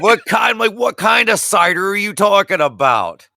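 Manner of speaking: high, insistent questioning; exasperated confusion. Retold or imitated. Mitch demanding the cider type from Bill, exasperated. Retold escalating baffled question.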